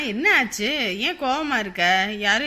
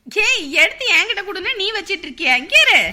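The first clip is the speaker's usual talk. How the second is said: scolding; 7 semitones higher, 5 dB louder than usual. Acted. Mother scolds the child.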